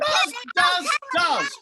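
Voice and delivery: high loud insistent does; shrieking panto retort. Acted. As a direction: shriek it over the crowd. Escalating panto argument.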